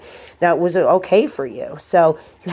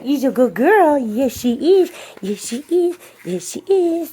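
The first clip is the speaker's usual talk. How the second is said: rising higher and higher sing-song; doggy praise; 7 semitones higher than usual. Real, not acted. Trainer praising dog.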